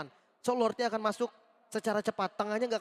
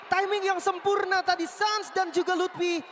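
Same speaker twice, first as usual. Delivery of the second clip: high, loud hype shouting; fighting game announcer; 9 semitones higher, 5 dB louder than usual. Real, not acted. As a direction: scream the triple kill like an announcer. Caster screaming over triple kill.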